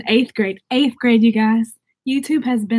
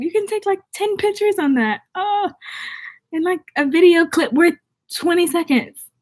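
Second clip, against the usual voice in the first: much higher, amazed at how tiny it was; incredulous; 7 semitones higher than usual. Real, not acted. Marveling at tiny SD card.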